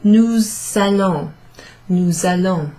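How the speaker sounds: "Nous allons" run together with a Z sound; teacher modelling the liaison. Shown as a demonstration. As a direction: say "Nous allons" as one word, Z linking. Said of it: "it sounds a bit like a Z".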